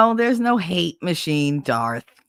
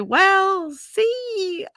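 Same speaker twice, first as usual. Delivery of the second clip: very high, stretched-out words; mock-innocent high singsong; sheepish stalling. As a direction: stall sweetly before confessing. Retold sheepish confession to friend.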